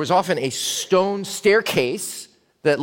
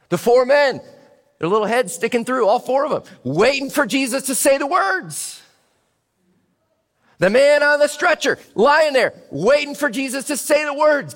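higher and louder, building tension; rising suspense; 8 semitones higher, 4 dB louder than usual. Acted. Preacher to congregation, building high and loud suspense. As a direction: hold them on the edge of anticipation.

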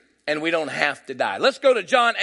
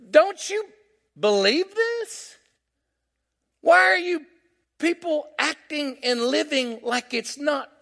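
high, slow, pleading preacher; impassioned preaching; 8 semitones higher, slower than usual. Real, not acted. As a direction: press the congregation with drawn-out, high-pitched questions.

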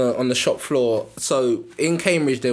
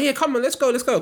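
eager call; 10 semitones higher than usual. Retold or imitated. Richie ready to fight.

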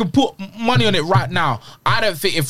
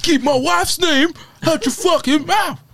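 mock stage shout; 8 semitones higher, slower than usual. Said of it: "you wouldn't have gone on stage".

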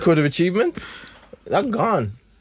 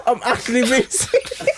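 higher-pitched claim cracked by laughter; laughing; 8 semitones higher than usual. Real, not acted. Answering a friend who asks why he's laughing, laughing. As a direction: try to insist through real giggles. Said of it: "So why are you laughing?".

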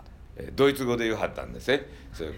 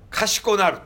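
playful punch; 9 semitones higher, 5 dB louder, slower than usual. Acted. Punchline about the left spring.

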